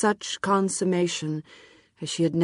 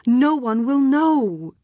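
slow, drawn-out desperate plea; slow it right down, pleading; slow pitiful plea; 7 semitones higher, 4 dB louder, slower than usual. A narrator's reading. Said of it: "pitiful also".

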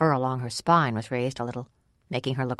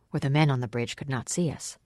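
usual speaking voice

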